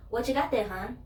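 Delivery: chirpy cashier asking across the counter; imitating a curious store clerk; nosy cashier. Cashier asks again, retold.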